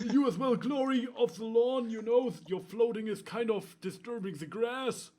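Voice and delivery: junkie Blackjack voice. Acted. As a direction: play a burned-out addict rambling. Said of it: "this is my old junkie Blackjack voice".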